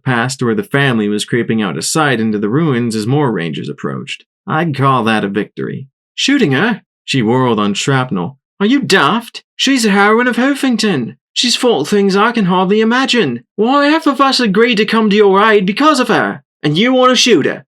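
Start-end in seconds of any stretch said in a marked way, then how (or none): 6.1-17.6 put-on higher, louder outraged English accent; indignant English accent. A narrator's reading. Crumpets scolding Shrapnel in an English accent.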